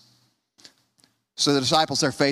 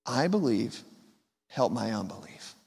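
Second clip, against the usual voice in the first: quiet plea; 4 semitones lower, 6 dB quieter than usual. Acted. Father's cry of doubt.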